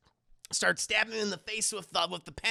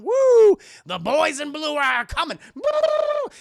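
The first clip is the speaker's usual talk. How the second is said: loud high whooping; excited cheer; 9 semitones higher, 10 dB louder, slower than usual. Real, not acted. Streamer cheering the cops coming, loud whoops. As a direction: cheer the cavalry arriving.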